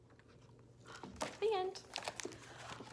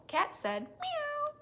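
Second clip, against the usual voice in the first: cat's reply; much slower than usual. Acted. Cat answers.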